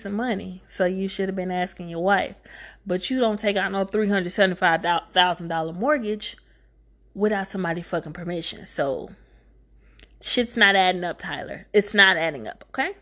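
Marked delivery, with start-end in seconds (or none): none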